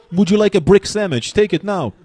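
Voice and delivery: mock Eastern European accent offering a date; mock Polish suitor. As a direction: play a clumsy Polish charmer.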